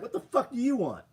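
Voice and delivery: high, loud mock rudeness; imitating a rude counter worker, high and loud; imitating rude staff. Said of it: "I'd at least laugh if they're like".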